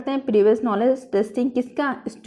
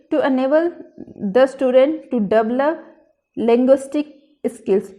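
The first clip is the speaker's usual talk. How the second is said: very slow, word by word, for students to write down; slow dictation; 4 dB louder, much slower than usual. Shown as a demonstration. Teacher dictating the general aim to her trainees, slow and deliberate. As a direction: spell out each word slowly as if dictating notes.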